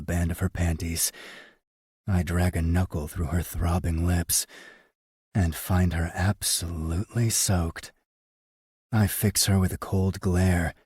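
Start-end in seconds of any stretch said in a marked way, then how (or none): none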